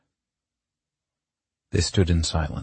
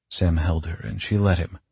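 plain reading voice

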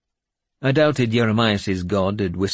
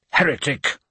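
spat; 6 semitones higher than usual. Acted. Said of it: "Swithred spat".

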